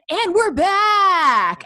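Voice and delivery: booming, drawn-out, high-pitched on-air shout; over-the-top radio DJ. Retold or imitated. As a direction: blast it out like a cheesy radio host. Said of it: "they just go".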